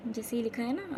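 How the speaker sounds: ordinary talk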